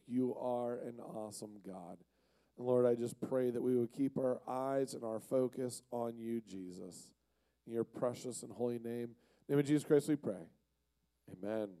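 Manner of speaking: soft, hushed opening prayer. Real, not acted. Pastor opening the sermon in soft prayer. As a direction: pray quietly to God before the talk.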